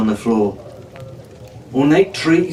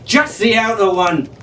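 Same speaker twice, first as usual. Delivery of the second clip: higher, impatient explanation; exasperated; 6 semitones higher than usual. A narrator's reading. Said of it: "he said in exasperation".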